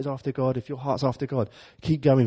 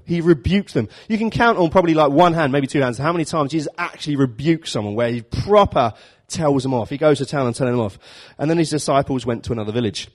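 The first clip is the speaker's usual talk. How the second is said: normal talking voice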